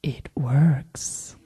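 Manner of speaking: sly, low whisper; conspiratorial whisper. Retold or imitated. Friend confiding that the doll works, conspiratorial. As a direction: whisper it like a gleeful secret.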